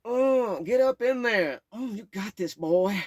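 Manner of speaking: high, loud hyped-up encouragement; high excited cheering. Retold or imitated. Woman dancing, hyping up the boy: loud high cheer. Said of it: "say things like".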